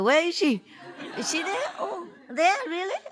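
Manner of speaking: playful surprise. Real, not acted. Searching for the master on screen.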